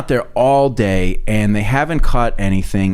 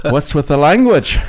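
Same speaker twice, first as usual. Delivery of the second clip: shocked reproach; 5 semitones higher, slower than usual. Acted. Friend to priest who just swore, in shocked reproach. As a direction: call the priest out, aghast.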